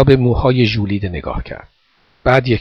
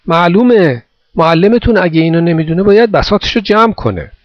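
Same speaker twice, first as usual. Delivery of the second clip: raised, higher voice carrying over; calling across; 6 semitones higher, 4 dB louder than usual. A narrator's reading. Man over the wall judging the boy's teacher, calling across. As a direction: call it firmly from the other side. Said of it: "از آن سو گفت".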